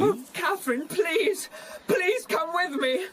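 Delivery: high, tearful begging; desperate pleading. Acted. Terrified boy begging his cousin along, tearful plea. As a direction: beg her, close to crying.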